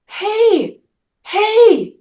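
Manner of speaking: put-on high, thin old-man call of hey, hey. Old man calling out to the drunk, high and light. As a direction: pitch it way up like a frail old man. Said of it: "this old man goes".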